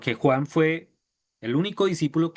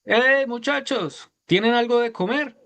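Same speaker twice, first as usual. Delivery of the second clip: Jesus calling from shore; 9 semitones higher than usual. Acted. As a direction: call out gently from the beach to the fishermen. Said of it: "una voz que dice".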